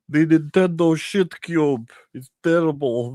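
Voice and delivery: mock angry gamer in a put-on accent; angry gamer impression. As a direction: rant like a furious online gamer. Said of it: "pissed off angry gamer is what he's called".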